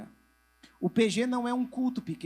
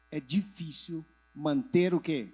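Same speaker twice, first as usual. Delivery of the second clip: ordinary talk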